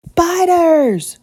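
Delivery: high, loud cry of fright at spiders; frightened cry. Acted. Guest sees spiders, cries out in fright. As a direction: shriek the word out in alarm. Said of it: "cried Mister Snore".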